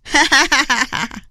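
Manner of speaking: villainous queen's cackle; acted wicked "ha-ha-ha" laugh; loud gloating laugh. A narrator's reading. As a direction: laugh out in smug triumph. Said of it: "cười lớn".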